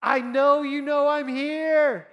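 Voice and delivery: very high, loud and slow call; excited shout. Retold or imitated. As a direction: shout so your son knows you're there. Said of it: "I'm like".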